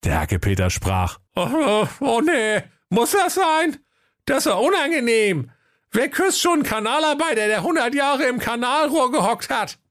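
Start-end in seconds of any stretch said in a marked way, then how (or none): 1.4-9.8 squeaky prince, shrewd and demanding; a sly little prince laying down terms; high squeaky prince. Acted. Hacke Peter disgusted. (piepsiger, gewitzter Prinz)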